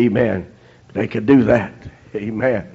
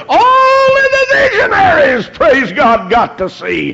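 fervent preaching shout; 8 semitones higher than usual. Acted. Preaching cry.

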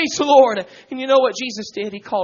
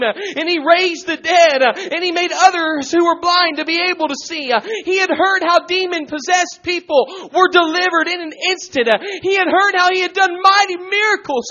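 raised, driving preacher's delivery; full-force preaching; 8 semitones higher, 10 dB louder than usual. Real, not acted. Preacher urging congregation off the wayside, full-force preaching. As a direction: preach it loud and high.

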